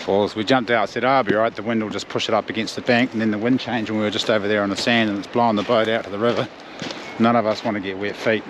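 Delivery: ordinary talk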